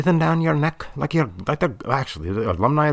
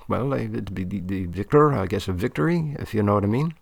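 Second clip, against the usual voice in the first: ordinary delivery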